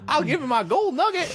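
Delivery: put-on high old-timer miner voice; old prospector. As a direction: offer it like a proud gold miner. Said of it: "Oh, stop the prospector".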